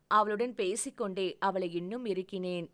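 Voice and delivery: normal talking voice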